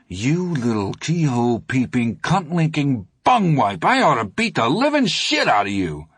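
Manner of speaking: rising, relishing mock threat; lyrical insult. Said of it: "waxed positively lyrical".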